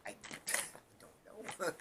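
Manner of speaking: slow, high, retold cluelessness through laughter; helpless laughing. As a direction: laugh through a baffled reply. Retelling own helpless answer, laughing.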